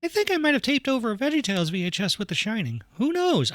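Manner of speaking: high-pitched, tipsy mother character; Mary's voice. Acted. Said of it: "I don't remember what her voice is".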